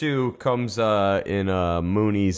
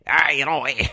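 Canadian Beavis voice; 5 semitones higher than usual. Retold or imitated. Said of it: "Canadian Beavis voice".

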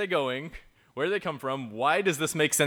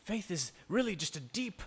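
higher, slow, a touch of preacher swell; mock preacher; 5 semitones higher, slower than usual. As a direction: ham up an earnest sermon. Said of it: "bad habit of doing in church".

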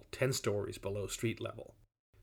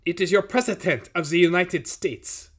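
put-on loud, higher, German-accented announcement; funny German accent; 5 semitones higher, 6 dB louder than usual. A narrator's reading. Nazi leader announcing himself at the door, German accent. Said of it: "some guy with a funny accent".

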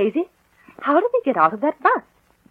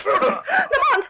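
frantic scream; 11 semitones higher than usual. Acted. Captive woman screaming for help.

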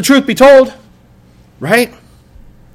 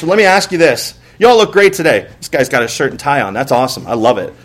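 ordinary delivery

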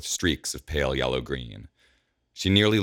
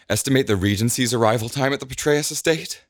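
hoarse; 10 semitones higher, 3 dB louder than usual. Acted. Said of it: "Alondra says hoarsely".

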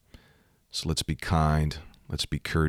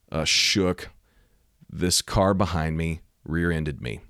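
ordinary talk, nothing marked

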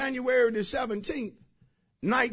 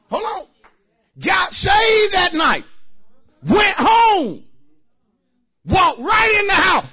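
loud, high, slowed shouted preaching; preaching at full force; 12 semitones higher, 11 dB louder, slower than usual. Real, not acted. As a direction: lift the church with it. Preaching testimony climax.

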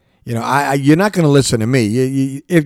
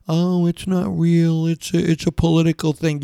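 mock dismissive skeptics; 5 semitones higher than usual. The host mocking mask skeptics, scornful. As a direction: sneer it out like a denier. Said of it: "these people around here talking about".

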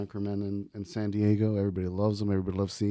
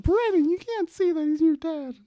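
high, louder mock mom scolding; mom's reaction; 4 dB louder than usual. Mother scolds her son in the car, high mock falsetto. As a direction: squeak it out like a shocked mother. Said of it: "just the mom's reaction".

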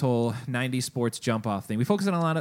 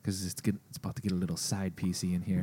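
whispering; 5 semitones lower, 8 dB quieter than usual. Acted. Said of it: "Why are we whispering?".

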